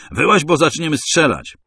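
high, loud threat hammered on Wyłaź; threatening shout. A narrator's reading. Masai threatening the hidden narrator, loud shout. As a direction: bark the ultimatum. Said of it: "krzyknął w Swahili Masaj".

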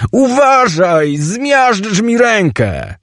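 very high, slow, drawn-out wail; pained yell. Acted. Chimek warns Teoś he is crushing his hand, high pained wail. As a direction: wail a warning as your hand hurts. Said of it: "wrzasnął Chimek, łapiąc się za rękę".

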